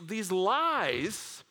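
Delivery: usual speaking voice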